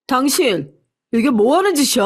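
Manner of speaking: a mild narrated shout; shouting at wife. A narrator's reading. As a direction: confront the wife in anger. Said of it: "아내에게 소리쳤습니다". (아내에게 화내며 소리치는 남편)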